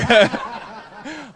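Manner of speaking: sudden high-pitched laugh. Real, not acted. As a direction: laugh at your own cold. Laughs at being cold.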